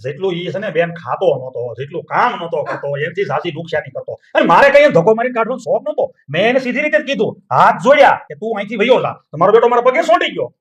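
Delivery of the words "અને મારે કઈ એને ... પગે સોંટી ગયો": loud, higher, heated self-defence; loud and higher, a brother justifying himself to his sister; angry outburst. Acted. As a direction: shout it out, fed up with the servant. Brother angrily defends throwing servant out.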